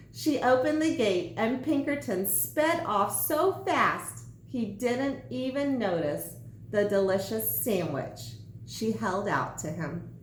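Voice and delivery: ordinary delivery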